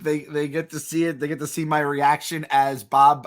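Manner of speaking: normal talking voice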